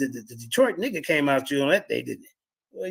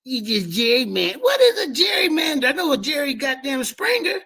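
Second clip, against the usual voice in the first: incredulous shout; 8 semitones higher than usual. Real, not acted. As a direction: shout it like the word is absurd. Mock outrage at a word.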